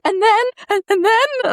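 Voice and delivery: high, loud mock-frightened voice; hammed-up squeaky fright; mock scared. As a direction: act terrified to read on, voice way up. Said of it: "I'd be so scared".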